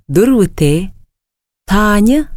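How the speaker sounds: usual speaking voice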